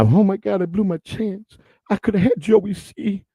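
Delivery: high, slow regretful whine; imitating Peaches. Imitating Peaches who blew his chance, high mock whine. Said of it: "He's, he's right now".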